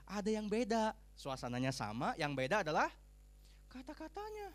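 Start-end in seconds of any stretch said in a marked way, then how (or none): none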